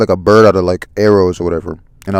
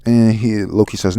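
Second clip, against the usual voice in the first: ordinary delivery, nothing marked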